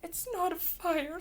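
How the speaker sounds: high-pitched, mildly upset monkey; a touch of a sniffle, read aloud; small monkey owning up, pitched high; sniffly little monkey. A narrator's reading. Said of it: "sniffs one little monkey".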